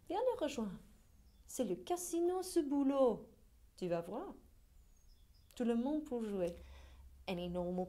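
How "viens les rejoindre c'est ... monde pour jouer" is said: high-pitched, forceful French tirade; heated challenge. Acted. Daring critics to come and join the work, heated challenge. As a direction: push the pitch up and challenge them.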